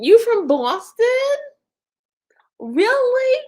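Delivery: high, loud mock Boston accent. Streamer greeting a viewer from Boston, playful Boston accent. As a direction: put on an excited Boston accent. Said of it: "I love the accent from Boston".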